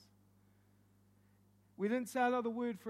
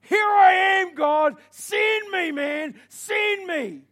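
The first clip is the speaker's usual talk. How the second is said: full-throated desperate shout, much higher and louder; screaming out; 12 semitones higher, 20 dB louder, much slower than usual. Retold or imitated. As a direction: scream it at the top of your lungs to God. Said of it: "I was screaming out in my car".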